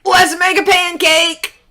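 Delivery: high, loud excited shout; eager shout. A narrator's reading. Jack begging his mother for a pancake, shouting. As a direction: belt it out like an impatient boy. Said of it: "shouted Jack".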